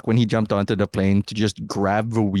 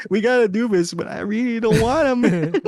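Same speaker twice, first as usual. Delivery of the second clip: high, reluctant mock whine; lightly hammed grudging fighters; 11 semitones higher than usual.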